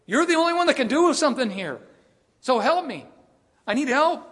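loud, high begging cry; mother pleading with Jesus for her daughter, loud and desperate; desperate plea. Acted. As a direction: throw yourself on his mercy out loud.

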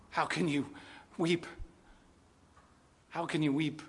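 hushed gentle Jesus; tender rebuke. Acted. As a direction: ask it softly, close and personal. Jesus addressing congregant gently.

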